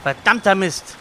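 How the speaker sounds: loud frustrated curse; angry curse. Real, not acted. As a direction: spit out the curse. Frustrated cursing.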